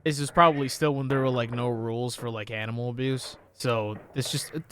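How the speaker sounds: normal talking voice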